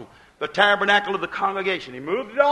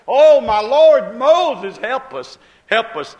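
a slow, loud "oh, my Lord" that falls into begging; pleading cry; 8 dB louder, slower than usual. Retold or imitated. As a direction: cry out for mercy, then plead "help us". Aaron begging Moses for help.

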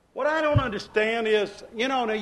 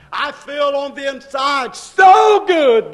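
slow build to a loud, high peak; drawn-out preacher's shout of joy; exultant shout; 6 semitones higher, 11 dB louder, slower than usual. Retold or imitated. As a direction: let praise burst out on "so good". Private praise swells into shout.

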